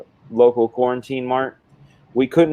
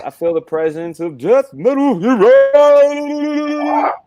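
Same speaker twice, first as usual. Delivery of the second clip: mock summoning wail; 12 semitones higher, 4 dB louder, slower than usual. Host heralds an arriving guest, drawn-out theatrical wail. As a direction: build to a high, loud, long-held cry.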